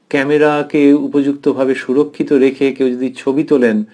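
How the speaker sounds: ordinary talk, nothing marked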